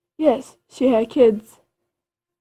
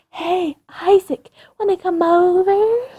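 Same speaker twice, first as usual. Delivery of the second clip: high invite; 7 semitones higher than usual. Acted. Praise inviting Isaac.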